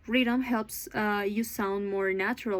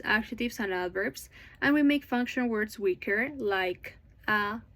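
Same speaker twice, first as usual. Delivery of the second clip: ordinary delivery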